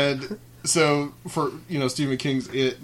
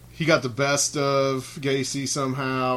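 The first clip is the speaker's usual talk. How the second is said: ordinary delivery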